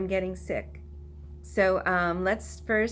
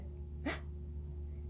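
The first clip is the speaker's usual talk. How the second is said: gasp; 17 dB quieter than usual. Shown as a demonstration. Mock surprise in song.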